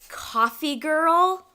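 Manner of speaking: slow, loud, indignant echo of the insult; offended disbelief. Acted. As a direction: throw the words back at him, stung. Barista bristles at "coffee girl.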